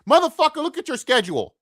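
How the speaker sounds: a sudden high exasperated yell; exasperated outburst. Real, not acted. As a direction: blurt it at the team in disbelief. Angry outburst at team.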